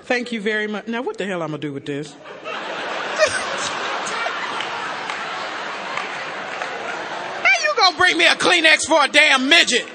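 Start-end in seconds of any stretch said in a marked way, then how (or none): 2.2-7.4 big laugh. Real, not acted. Audience gag, big laugh. 7.4-9.9 loud, laughing mock complaint; laughing outburst. Real, not acted. Laughing at the tissue giver, loud mock complaint. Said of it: "You see I'm emotional up here?".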